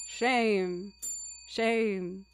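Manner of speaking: usual speaking voice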